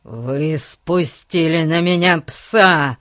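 loud, slow growled accusation; an indignant policeman's loud growl; angry growl. Acted. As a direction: growl it out in outrage, word by word. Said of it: "прорычал он".

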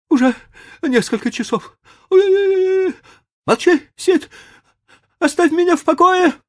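very high, loud mock agony; theatrical moaning. A narrator's reading. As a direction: wail it like a martyr.

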